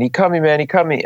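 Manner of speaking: high-pitched retold scream, not full volume; mock screaming. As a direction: imitate a panicked man crying out. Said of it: "gets cut starts screaming".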